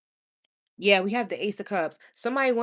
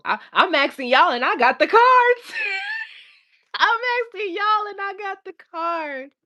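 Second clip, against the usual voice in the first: high laughing whine; giggling at her own question; 13 semitones higher, 6 dB louder than usual. Real, not acted. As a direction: laugh through the words, pitched up. Laughing at herself.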